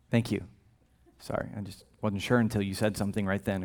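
soft, low deadpan thanks; dry mock gratitude; sarcastic. Jesus teasing his disciples with quiet, deadpan sarcasm.

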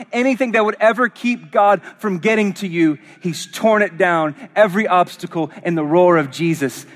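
impassioned preaching. Real, not acted. Preaches at climax.

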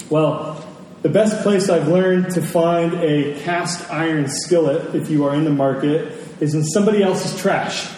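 normal talking voice

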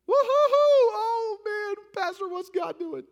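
high, loud whoop that sinks into a dismayed whine; hammed-up whoop, then a worried cry to the pastor; mock churchgoer cheer. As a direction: play the cheering believer who suddenly loses heart.

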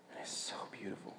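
soft whisper of wonder; awed whisper. Real, not acted. Preacher remembering the villagers' song, whispering. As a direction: whisper it, overcome.

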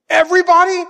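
the daughter in disbelief; shocked child. Retold or imitated. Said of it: "She goes".

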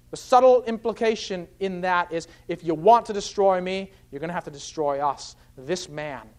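ordinary talk, nothing marked

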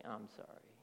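soft, quiet, meant-it sorry; a real sorry, gently given at last; sincere apology. Retold or imitated. Pastor voicing the child's sincere apology to her sister, softly.

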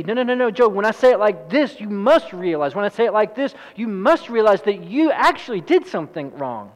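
high, loud, insistent arguing. Acted. Job's friends trying to make him see his wrong, insistent. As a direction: press the point until he gives in.

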